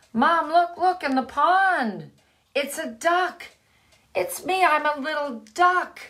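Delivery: high, loud thrilled discovery; excited duckling. Acted. Duckling calling Mom to the pond, excited and high. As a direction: shout the news to Mom.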